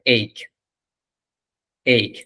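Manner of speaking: ache said as "এইক", a gliding ei closed by k; correct pronunciation. Shown as a demonstration. Teacher corrects a common error, correct pronunciation of "এইক". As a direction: say "এইক" as one syllable, ending in an aspirated k. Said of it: "এটার উচ্চারণ হচ্ছে".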